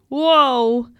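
storybook yelp of alarm; alarmed whoa. A narrator's reading. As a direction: cry out as if tumbling down. Character tumbling down in sub.

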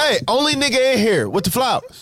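high-pitched mock holler; put-on high calling voice; imitated call. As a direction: pitch the voice way up as if calling someone over. Acted call from retold scene.

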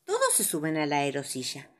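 ordinary delivery, nothing marked

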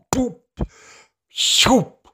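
high, loud boop and shoop sound effects; ball kick noises. Retold or imitated.

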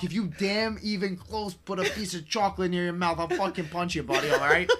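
loud, high hammed-up Azoff accent with laughs; Wolf of Wall Street voice. Coach on a call with a new client, loud hammed-up accent. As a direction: pump up the client like a pushy broker. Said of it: "in that accent".

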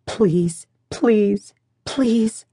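lightly voiced begging, lifting on each please; mild whimpered please; small pleading. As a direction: beg in a small voice. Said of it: "The small voice".

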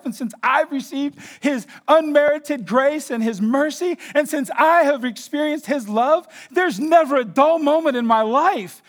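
high, loud, building excitement; fervent testimony. Retold or imitated. Believer telling how Christ changed his life, fervently excited. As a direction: pour out the retold testimony at full force.

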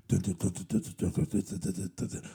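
low, soft hummed dun dun of thriller music; horror movie sting. Retold or imitated. Mocking Bake Off as a thriller, hums creepy low music. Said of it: "really tense music from, like, a horror movie".

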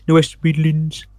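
a rough, self-mocking attempt at a West Midlands accent. Shown as a demonstration. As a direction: try the West Midlands accent, not quite landing it. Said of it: "that's a horrible attempt".

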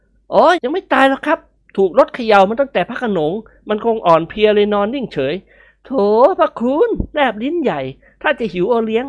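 lightly voiced cocky young man, amused; cheeky young man. Nikorn teasing the elders, lightly voiced. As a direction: tease the old folks with a grin.

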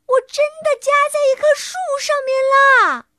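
high, loud, fast wail; acted high-pitched plea; insistent shout. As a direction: protest at the top of the voice. Wilbur insists he is stuck.

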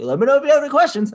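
high, fast, loud salesman voice; mock eager salesman pitch; mimicking pushy salesman. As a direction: squeak it out like a hovering clerk. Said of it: "He's like".

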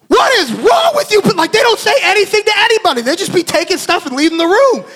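worked-up yelling at the staff; exasperated outburst. Retold or imitated. Outburst at staff leaving without explaining.

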